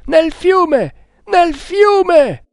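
high, loud repeated cry; urgent shout. A narrator's reading. Hunter ordering burning reeds into the river, urgent shout.

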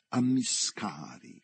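"Ammiscari" drawn out slowly; dialect word. Shown as a demonstration. Explaining his dialect's word for contagion, "Ammiscari" slow and deliberate. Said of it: "come si dice nel mio dialetto".

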